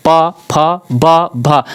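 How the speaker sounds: each lip sound said apart, slowly; sounding out letters. Shown as a demonstration. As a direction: say प, फ, ब, भ one by one to feel the lips. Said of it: "जब आप बोलेंगे खुद आप जान जाएंगे". (प, फ, ब, भ को अलग-अलग उच्चारित करना)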